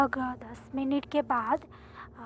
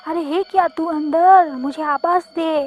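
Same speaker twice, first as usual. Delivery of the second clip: loud calling out for someone; shouting; 3 semitones higher, 10 dB louder than usual. Acted. Narrator calls for her missing friend inside, shouting. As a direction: call through a locked gate, voice raised. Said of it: "फिर मैंने चिल्लाया".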